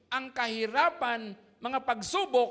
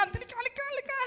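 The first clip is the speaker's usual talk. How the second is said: put-on much higher excited child voice calling friends; kids calling; 13 semitones higher, much faster than usual. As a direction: call the other kids over in a high voice. Kids calling each other over.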